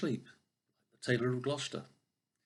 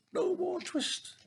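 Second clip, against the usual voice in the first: very high, slow squeak; twittering mouse shout; slower than usual. Acted. Mice shutting out Simpkin, high slow squeak. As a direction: squeal it like tiny mice in triumph. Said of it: "shout at once in little twittering voices".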